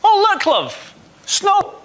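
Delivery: high, breezy and unbothered; mock-cheery Scot waving off the snow; casual Scottish shrug. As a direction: shrug off the snow like it's nothing. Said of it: "Scottish people do this".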